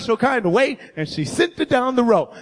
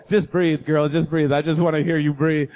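low romantic murmur; 7 semitones lower than usual. Retold or imitated. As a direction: murmur it like a lover. Romantic phone breathing.

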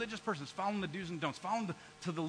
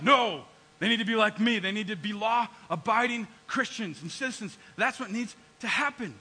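louder, indignant insistence; Jonah mad; 2 semitones higher, 8 dB louder than usual. Retold or imitated. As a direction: demand it like an offended believer. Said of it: "Jonah's mad at that".

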